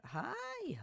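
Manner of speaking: very high squeaky greeting. Pretend. Gamer greeting a villager, squeaky hi. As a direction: squeak it like a cute creature.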